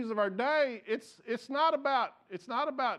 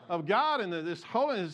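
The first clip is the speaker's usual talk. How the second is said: normal talking voice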